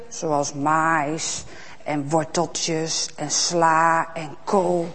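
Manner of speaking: slow, soft, savouring each vegetable; slow soft listing. Acted. Storyteller listing crops for children, slow and soft. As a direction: list them slowly and quietly.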